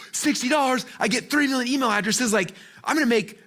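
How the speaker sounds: high-pitched, giddy disbelief; retold wide-eyed excitement; amazed excitement. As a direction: react like you found an unbelievable bargain. Acts out his amazed younger self.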